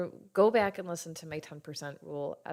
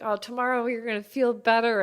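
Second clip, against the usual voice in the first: cheery husband; 6 semitones higher, 10 dB louder than usual. Retold or imitated. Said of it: "he's like".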